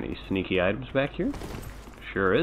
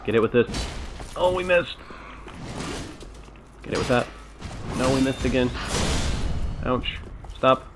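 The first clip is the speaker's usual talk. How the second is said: raging gamer; 5 semitones higher than usual. Real, not acted. Frustrated fighting, rising voice.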